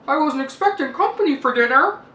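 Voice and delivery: lightly voiced lady, mouth full; a hint of chewing through the words; mumbling with mouth full. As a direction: talk through a mouthful of meatballs. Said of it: "she mumbled with a mouthful of meatballs".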